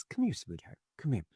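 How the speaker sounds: acted tender parent voice; comforting mommy. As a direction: gently reassure a frightened little child. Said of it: "And Mommy says".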